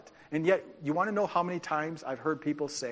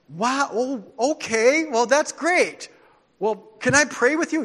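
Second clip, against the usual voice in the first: high, loud stammering recovery; flustered surprise; 8 semitones higher, 9 dB louder than usual. Retold or imitated. Stumped mall evangelist recovering, offering to pray, flustered. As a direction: scramble to save face. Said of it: "He go".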